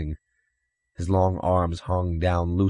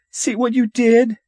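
very high, loud accusation; shrill it like a child near tears; 6 dB louder than usual. A narrator's reading. Tearful boy blaming his brother, shrilling. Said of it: "Stephen shrilled, tears filling his eyes".

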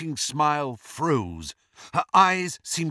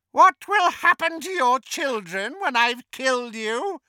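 mocking taunt; 11 semitones higher than usual. A narrator's reading. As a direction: taunt your victim in a high, wicked voice. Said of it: "taunted Bellatrix".

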